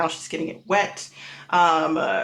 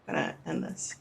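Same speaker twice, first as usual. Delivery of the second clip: hushed; 10 dB quieter than usual. Acted. GM quietly closing the scene, hushed. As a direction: drop to a near whisper. GM drops to a hush.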